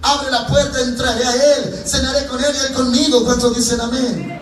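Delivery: high, ringing scripture proclamation; impassioned preacher, high and insistent; full-force preaching. Acted. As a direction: proclaim the verses from the pulpit.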